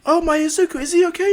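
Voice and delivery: high, loud motherly alarm; high-pitched panicked question; worried mother. Acted. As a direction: fret urgently in a high voice. Mother alarmed about her son.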